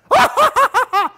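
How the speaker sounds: high cackling laugh at the victim; mocking laugh. Shown as a demonstration. Teacher as a bully laughing at the insult he just made, mocking. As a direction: laugh meanly at your own joke.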